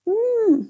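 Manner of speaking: high pleased "mmm" while tasting; savoring hum. Real, not acted. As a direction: hum with delight at the food.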